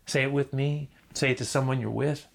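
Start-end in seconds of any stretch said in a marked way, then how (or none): none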